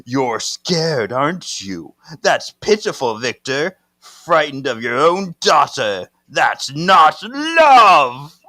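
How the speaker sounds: taunting put-on voice, building loud and high; mock him, stretching the last word; menacing otherworldly sneer, ending in a drawn-out cry; possessed ancient being.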